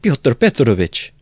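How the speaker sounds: a mother drawing herself up; with dignity. Acted. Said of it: "declared with dignity".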